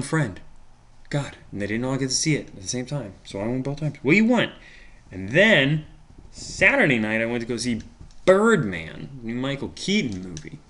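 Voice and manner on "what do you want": high, loud, fed-up; exasperated yell. Real, not acted. Vlogger yells at the interrupter once more, exasperated. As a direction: shout it off to the side, sick of the interruptions.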